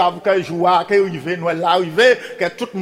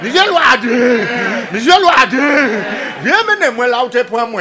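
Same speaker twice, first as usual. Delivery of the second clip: calling out; 4 semitones higher, 5 dB louder than usual. Acted. Pig calls out to Loaden.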